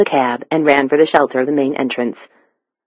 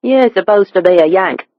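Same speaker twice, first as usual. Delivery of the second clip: higher-pitched Cockney stranger; Cockney accent; 4 semitones higher, 3 dB louder than usual. A narrator's reading. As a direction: say it in thick London Cockney. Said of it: "The stranger's Cockney accent was nearly impenetrable".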